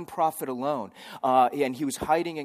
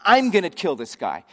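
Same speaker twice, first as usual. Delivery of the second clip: bossy wife; 5 semitones higher, 11 dB louder than usual. Retold or imitated. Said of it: "And his wife".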